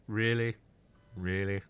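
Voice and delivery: lightly acted disbelief; sceptical. Voicing a doubtful look for a co-host, sceptical.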